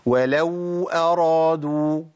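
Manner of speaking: "ولو أرادوا" with the waw stretched long, very slow; wrong elongated waw. Shown as a demonstration. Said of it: "ده خطأ".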